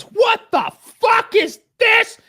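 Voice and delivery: high, slow, laughing yell of an angry mom; shocked mother yelling. Retold or imitated. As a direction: play the mom shouting at the crash, laughing through it. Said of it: "and then Lois goes".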